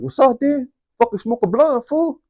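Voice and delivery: high, eager hey-dude greeting; friend calling up. Retold or imitated. Friend at the window greeting the homie, high and eager. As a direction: pitch it high and friendly to your buddy.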